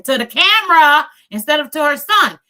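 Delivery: loud, high emphatic complaint; suddenly loud, indignant criticism; exasperated outburst. Real, not acted. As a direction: raise your voice in exasperation.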